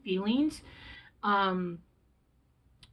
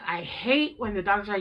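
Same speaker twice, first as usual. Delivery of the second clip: ordinary talk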